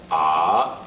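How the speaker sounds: "ah" dipping low then rising; third tone. Shown as a demonstration. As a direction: dip "ah" and lift it quickly. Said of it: "dip your pitch and then quickly raise it".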